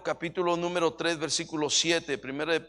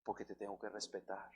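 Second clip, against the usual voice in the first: wife apologizing; 9 semitones lower, 19 dB quieter than usual. Retold or imitated. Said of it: "luego me dice".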